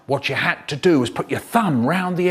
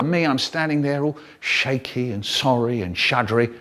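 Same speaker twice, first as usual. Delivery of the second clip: normal talking voice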